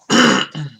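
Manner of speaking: loud sharp throat clear. Real, not acted. Throat clearing mid-game.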